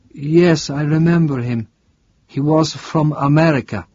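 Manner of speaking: a frail priest, suddenly alert; startled priest asking a stranger; confused old priest. Acted. Priest answers.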